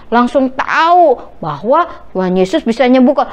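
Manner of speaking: ordinary delivery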